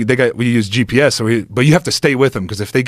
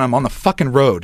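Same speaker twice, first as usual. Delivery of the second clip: normal talking voice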